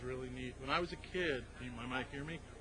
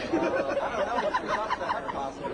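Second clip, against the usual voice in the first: breathy laugh; 6 semitones higher than usual. Real, not acted. Mocking laugh at heckler.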